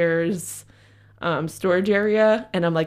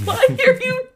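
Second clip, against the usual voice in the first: much higher, louder, whiny shriek of protest; startled manager; 4 dB louder, slower than usual. Retold or imitated. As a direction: squeal like the scared manager caught off guard. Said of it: "And she's like".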